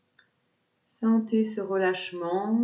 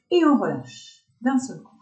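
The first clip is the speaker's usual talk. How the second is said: high, loud onset falling away; sudden release cue; 5 semitones higher, 5 dB louder than usual. Shown as a demonstration. Yoga teacher cueing students to drop the tension, sudden release. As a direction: let go all at once on a falling tune.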